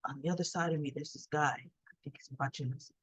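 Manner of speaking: retold near-whisper about the stranger; hushed. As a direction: tell her secretly so the guy can't hear. Friend whispering about a watcher.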